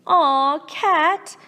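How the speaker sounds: ordinary delivery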